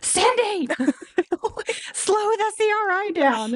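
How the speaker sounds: high-pitched urgent request; urgent high call. Retold or imitated. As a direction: call out to her, half laughing. Urgent call to vet tech.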